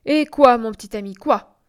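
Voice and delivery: indignant outburst in a story; exclaiming. A narrator's reading. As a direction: cry it out, hard on each "quoi". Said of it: "Il s'écriait".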